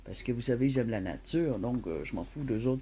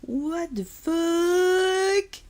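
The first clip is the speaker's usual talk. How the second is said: stunned; 5 dB louder, much slower than usual. Real, not acted. Stunned by the price.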